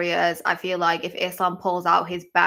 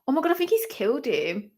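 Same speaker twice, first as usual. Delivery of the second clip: high, rushed horror; horrified; 7 semitones higher, faster than usual. Real, not acted. Viewer watching the fighter fall, high and horrified. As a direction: gasp it out fast. Knockout reaction.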